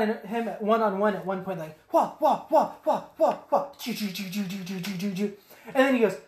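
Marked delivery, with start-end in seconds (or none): 1.9-5.3 lightsaber fight noises. Retold or imitated. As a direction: imitate a duel with mouth sounds.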